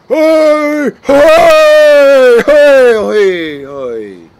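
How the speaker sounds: very high, very loud repeated yells; shouting hey. Retold or imitated. Extra yelling on set when he shouldn't. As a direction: bellow it across the set like a fool.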